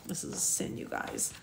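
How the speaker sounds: very quiet, unvoiced murmur to the listeners; hushed aside. Real, not acted. Reader breaking off to tell listeners it's a sin, hushed aside. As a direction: drop right down, almost under your breath. Shocked aside while reading.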